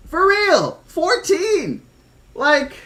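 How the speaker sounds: very high-pitched disbelief; incredulous outburst. Real, not acted. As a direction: exclaim it, amazed by the gift. Amazed at fourteen gifted subs.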